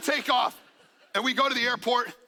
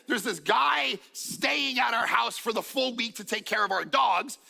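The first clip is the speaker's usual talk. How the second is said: ordinary talk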